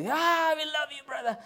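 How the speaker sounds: loud, very high mock squeal of love; hammed-up effusive churchgoer; gushing greeting. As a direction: parody an overjoyed welcome at full pitch. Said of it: "the next week we go".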